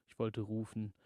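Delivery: plain reading voice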